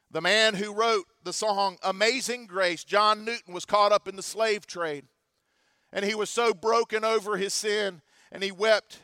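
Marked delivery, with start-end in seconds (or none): none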